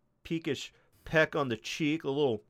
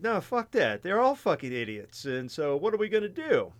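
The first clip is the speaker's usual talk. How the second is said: mock king; 6 semitones higher, faster than usual. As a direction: brush them all off as the king. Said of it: "the king at the time said".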